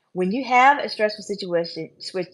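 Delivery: ordinary delivery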